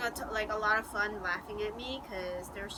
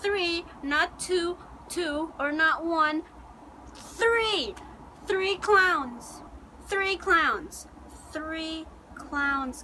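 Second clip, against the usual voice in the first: THREE hit high and loud, repeated; emphatic reveal; 5 semitones higher, 4 dB louder, much slower than usual. Real, not acted. Telling viewers about the clowns, emphatic on THREE. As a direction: punch each "three" as a separate shock.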